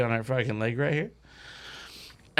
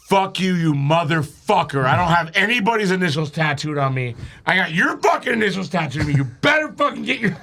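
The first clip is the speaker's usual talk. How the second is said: retold angry outburst. Storyteller voicing Crystal's reaction to the tattoo, mock-angry rant. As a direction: act out her indignant tirade, building in pitch. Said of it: "she got so mad".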